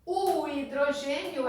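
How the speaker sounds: normal talking voice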